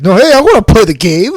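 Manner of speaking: high, loud gruff demand; a hint of gruff warrior; gravelly voice. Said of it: "He has, like, this gravelly voice".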